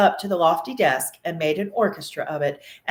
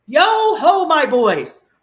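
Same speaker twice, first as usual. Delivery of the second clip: much higher, louder hearty hail; jovial call; 9 semitones higher, 9 dB louder, much slower than usual. A narrator's reading. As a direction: hail the lads like a jolly boss. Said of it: "said Fezziwig".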